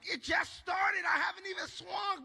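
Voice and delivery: high, loud cry of complaint; exasperated protest. Acted. Winded mid-tussle inner protest.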